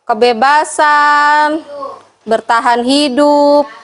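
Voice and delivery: very slow, stretched-out listing; slow dictation. Real, not acted. Teacher dictating the five basic needs to participants, slow drawn-out listing. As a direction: draw out each item for note-takers.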